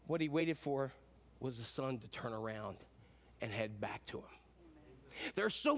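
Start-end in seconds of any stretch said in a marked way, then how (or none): 0.1-4.2 solemn preaching. Real, not acted. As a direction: drop deep and soft to land the point. Preacher lands the sermon's point.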